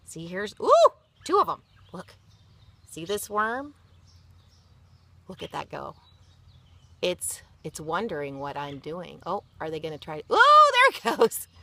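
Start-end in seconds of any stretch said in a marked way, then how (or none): 0.6-1.6 high, delighted discovery; excited ooh. Real, not acted. Adult showing worms to child, delighted. 10.3-11.5 high, loud thrilled cry; delighted ooh. Real, not acted. Adult watching worm burrow, thrilled cry.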